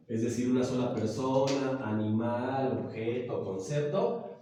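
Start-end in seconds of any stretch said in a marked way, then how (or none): none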